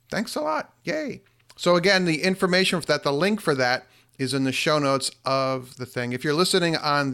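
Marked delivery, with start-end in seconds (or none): 0.1-1.2 excited. Real, not acted. Cheering on new patrons.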